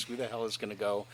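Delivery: normal talking voice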